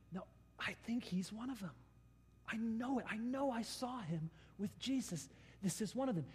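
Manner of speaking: hushed, gossiping accusation; servant girl to bystanders. Acted. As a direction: tell them quietly he's one of them. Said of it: "she speaks to some of the bystanders".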